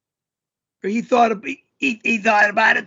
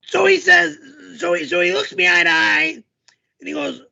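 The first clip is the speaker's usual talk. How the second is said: high, put-on storyteller voice; character voice pitched well up; yelling character voice; 4 semitones higher than usual. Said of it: "back up and do it again".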